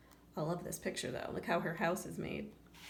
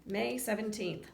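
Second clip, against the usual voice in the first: ordinary delivery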